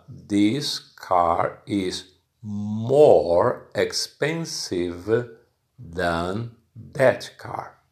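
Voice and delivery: slow, deliberate classroom example; slow example sentence. Shown as a demonstration. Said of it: "I need to use".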